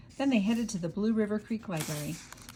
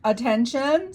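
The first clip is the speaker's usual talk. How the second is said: much louder, higher call over the speakers; loudspeaker announcement; 6 semitones higher, 9 dB louder, slower than usual. A narrator's reading. Librarian calling the crowd over the loudspeaker, loud. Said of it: "over the loudspeaker".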